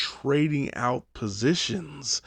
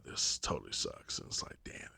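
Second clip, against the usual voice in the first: unvoiced whispered gripe; whispered complaint about cookies; 15 dB quieter than usual. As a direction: hiss it under your breath. Secret complaint.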